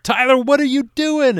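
high, loud imitation of yelling at a student; startling high-pitched reprimand, acted out; retold yell. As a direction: yell it out to scare him. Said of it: "I really yelled at him".

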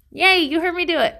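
high, bright little cheer; playful self-cheer. Pretend. Host celebrating that listeners heard her, high playful cheer. Cheering herself for admitting softness.

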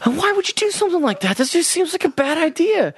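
high, fretful mock worry; dad commenting. Dad talking to the TV as if he were there, high fretful. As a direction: fret at the screen like an anxious dad. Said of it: "he'll be like".

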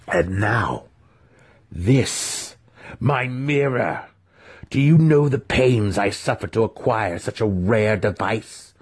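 slow, seething rebuke; a seething master scolding the trapped youth; growling master. A narrator's reading. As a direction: let the fury simmer. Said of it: "the faceless one growled at him".

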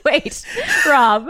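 usual speaking voice

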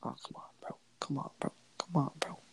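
very soft, whispered urging; hushed, private plea; pleading whisper. Real, not acted. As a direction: whisper it at the game under your breath. Muttering at the game he watches.

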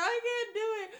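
high, pleading whine; mock whine. Repeating to her pastor, a whining plea. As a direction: keep whining it over and over. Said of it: "I just kept saying".

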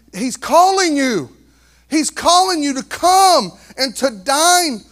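high, slow and loud; slow pulpit appeal; slow, loud preaching. Acted. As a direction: draw each word out over the crowd.

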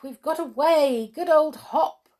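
high, excited cheering; jubilant shout. A narrator's reading. Skip praising Hop after escaping, jubilant call. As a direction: crow over the escape. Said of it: "shouted Skip".

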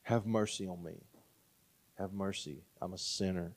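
low, hushed plea for mercy; quiet, remorseful prayer; pained, guilty plea. Acted. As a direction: confess softly, weighed down by guilt. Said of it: "through his pain and his guilt".

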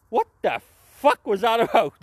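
loud, high baffled outburst. Retold or imitated. Recalling his reaction to Kalisto, loud high disbelief. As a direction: react like it made no sense at all. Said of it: "and I was like".

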